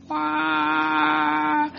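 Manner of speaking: high, long held "waah" like a trumpet; one sustained high-pitched vocal trumpet note; imitated trumpet blast. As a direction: mimic a trumpet sounding with the voice. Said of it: "this trumpet sound happened".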